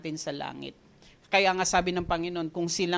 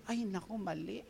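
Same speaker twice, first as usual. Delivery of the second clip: much softer, quiet realization; suddenly calm; 2 semitones lower, 12 dB quieter than usual. Retold or imitated. Preacher catching himself after yelling on the bus, soft and calm. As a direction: drop the voice, catching yourself. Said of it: "Bigla akong kalma".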